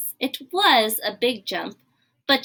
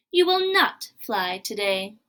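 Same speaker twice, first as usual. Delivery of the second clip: ordinary delivery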